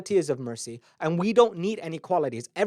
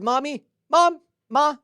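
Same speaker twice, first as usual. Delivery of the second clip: put-on high, loud calling like a lost little boy; crying child calling; 8 semitones higher, 4 dB louder, slower than usual. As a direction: cry out for mommy, lightly voiced child.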